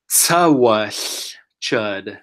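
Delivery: "tsawash chud" drawn out very slowly; slow demo. Shown as a demonstration. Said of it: "So let's say this word".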